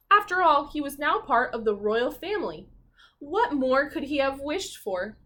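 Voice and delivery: lively, expressive storybook narration; reading to children. A narrator's reading. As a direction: read it like a bedtime picture book.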